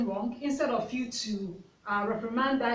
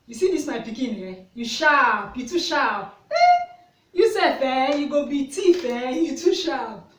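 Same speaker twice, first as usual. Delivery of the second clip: loud, animated Pidgin-speaking mother; joking neighbour; 3 semitones higher, 8 dB louder than usual. Retold or imitated. Neighbour joking to her son that he's sharp, loud teasing. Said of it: "She meant it as a joke".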